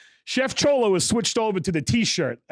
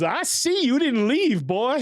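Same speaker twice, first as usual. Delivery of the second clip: high, slow drawn-out taunt; sing-song mock menace; imitating Chef Clark; 6 semitones higher, slower than usual. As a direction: mimic a tough instructor looming over a student. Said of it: "he goes".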